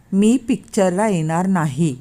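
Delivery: slow, deliberate refusal; wife refusing the movie, said slowly. Acted. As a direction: spell out each word slowly. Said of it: "मी सावकाश म्हणाले".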